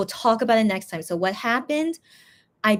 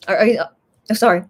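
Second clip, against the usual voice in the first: hiccuping; 6 dB louder, much faster than usual. Real, not acted. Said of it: "Now I have the hiccups".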